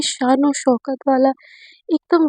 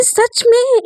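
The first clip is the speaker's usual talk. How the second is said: surprised; 10 semitones higher, 3 dB louder, much slower than usual. Acted. Naina can't believe she agreed.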